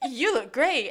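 mock-smitten, awkward outburst; flustered compliment. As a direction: blurt the compliment too loudly. Acting out flustered Gus complimenting Mira.

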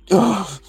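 high gasping breath; big gasp of air. Acted. Player acts her character catching air, big gasp. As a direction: gasp as if finally getting air. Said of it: "like, a big gasp of air".

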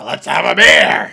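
loud, slow wrestler growl; Stone Cold. Retold or imitated. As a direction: bellow it like a pro wrestler. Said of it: "Stone Cold, he's gotta".

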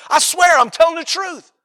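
high-pitched, imitated protest of honesty; insistent oath. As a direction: plead to be believed, hammed up. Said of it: "But we say that sometimes".

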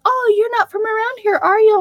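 high-pitched mock of curious strangers; imitating nosy locals. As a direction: mimic people pointing out an outsider. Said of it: "people are like".

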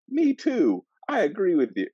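very high, eager agreement; clueless prince voice. Retold or imitated. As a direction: chirp it like an oblivious fool.

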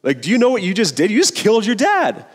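pressure the cub with mock alarm; urgent Scar. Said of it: "and his uncle's like".

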